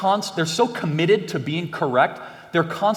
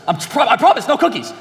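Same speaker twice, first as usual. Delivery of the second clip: mock pleading denial; 9 semitones higher, 4 dB louder than usual. Jack denying with crumbs on face.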